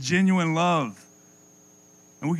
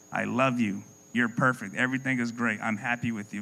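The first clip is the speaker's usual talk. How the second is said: lower, rote mock wife reciting niceties; programmed wife; 7 semitones lower, 6 dB quieter than usual. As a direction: recite it like a wound-up doll.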